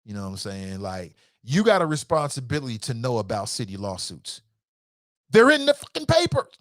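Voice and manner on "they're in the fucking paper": high, loud exasperated outburst; worked up, emotional. Real, not acted. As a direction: burst out in real exasperation. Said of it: "I'm getting emotional".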